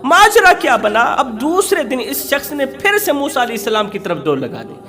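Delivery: ordinary talk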